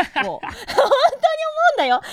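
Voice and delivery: high, drawn-out laughing exclamation. Real, not acted. Actress on how unsayable the lines are, laughing. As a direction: burst out laughing as you say it.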